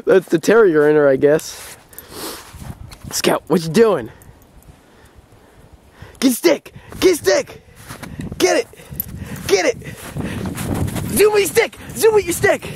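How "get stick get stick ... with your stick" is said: high, eager urging; excited dog talk. Real, not acted. Owner egging her dog on to fetch, high and eager. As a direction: pump the dog up to grab the stick.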